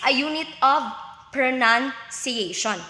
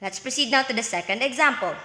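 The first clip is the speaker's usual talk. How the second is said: usual speaking voice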